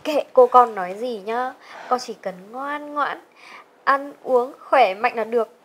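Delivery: put-on slow, gentle words to the baby; tender motherly. Mother speaks to unborn baby.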